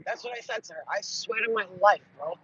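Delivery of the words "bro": ordinary delivery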